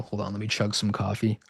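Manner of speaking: ordinary delivery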